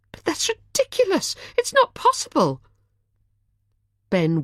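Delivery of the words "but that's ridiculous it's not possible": high, stunned disbelief; incredulous. A narrator's reading. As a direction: cry out that it can't be true.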